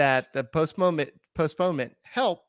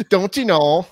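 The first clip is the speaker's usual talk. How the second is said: laughing, higher and louder Minnesotan; Minnesota accent; 4 semitones higher, 9 dB louder than usual. Acted. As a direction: giggle through a Minnesotan "Don't you know". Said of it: "Minnesota accent bit".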